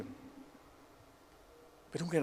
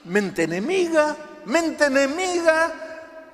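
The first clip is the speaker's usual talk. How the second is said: high, loud cry, stretched at the end; desperate outburst; 14 semitones higher, 7 dB louder, slower than usual. Acted. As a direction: cry out against the enemy mind. Preacher acts desperate outburst. (grito agudo, fuerte y desesperado)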